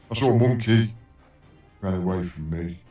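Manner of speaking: normal talking voice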